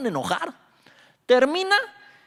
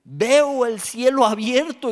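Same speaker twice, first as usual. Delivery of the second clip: slow, loud, high cry of wonder; exclaiming; 9 semitones higher, 10 dB louder, slower than usual. A narrator's reading. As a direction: proclaim it slowly to the heavens. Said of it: "exclamó".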